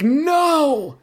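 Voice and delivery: hammed-up high girly protest; mock Carly. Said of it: "Carly said something along the same lines".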